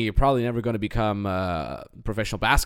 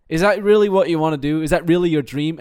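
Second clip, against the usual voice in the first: louder, higher pointed questions; host mother pushing him, half-mock; challenging demand; 5 semitones higher, 7 dB louder than usual. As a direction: press him hard on his dream.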